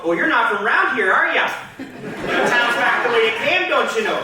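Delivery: put-on lilting Midwestern accent with rising tag question; sing-song mock Midwesterner. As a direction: put on a folksy, passive-aggressive Midwestern voice. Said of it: "had a Midwesterner go".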